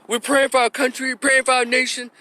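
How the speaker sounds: high-pitched mock pious plea; mocking echo. Commentator throwing the mama's prayer back, mocking. Mockingly echoing the praying mama.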